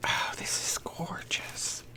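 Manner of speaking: slow hushed whisper of wonder; awed whisper. Real, not acted. Vlogger marvels at Susan's gift, slow and whispered. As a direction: whisper it as if afraid to break it.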